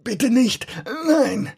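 high, choked crying plea; muffled cry. A narrator's reading. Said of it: "eine heisere Stimme flehte".

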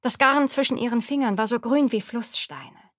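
usual speaking voice

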